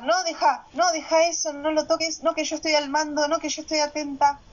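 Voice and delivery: playfully hammed-up keep-out-of-my-kitchen; bossy control. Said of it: "acaparaba todo yo".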